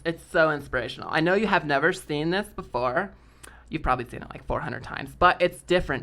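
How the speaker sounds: put-on upbeat, lilting sorority girl; valley girl voice. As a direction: play the bubbly sorority girl. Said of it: "Valley girl voice".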